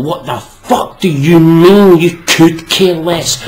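incredulous outburst. Acted. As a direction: demand an answer, slowly. Incredulous rant.

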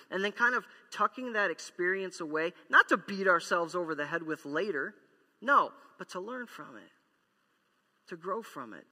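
ordinary talk, nothing marked

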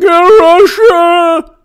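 high, loud mock shriek; shrieking mock panic. Mocking Democrats after the loss, high shrieking wail. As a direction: wail it like a hysterical loser. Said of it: "And then when they lost, like".

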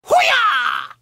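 loud high whoop; excited yell. Real, not acted. Wasabi hits, yelling.

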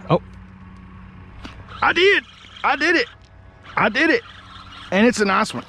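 high, thrilled I did it; excited. Real, not acted. Angler catching a fish on his last minnow, thrilled. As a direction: burst out with triumph.

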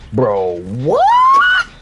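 high, loud startled cry; sudden shocked outburst. Real, not acted. Startled in game.

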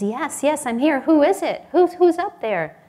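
high, loud, urgent reply; acting out a stranded man calling back; excited calling. Jack calls back up the cliff.

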